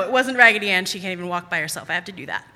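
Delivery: ordinary talk, nothing marked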